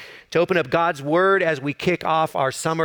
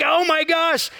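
sudden much higher cry of pain; pained yelp; 11 semitones higher, slower than usual. Retold or imitated. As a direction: yelp as if your hand just hit the floor hard. Hand hurts after hitting the floor.

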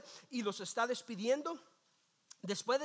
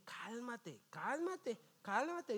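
starting very soft, then growing; calm your neighbor, hushed at first; soothing repeat; 6 dB quieter, much slower than usual. Shown as a demonstration. Preacher showing the church how to calm a neighbor, soft then rising.

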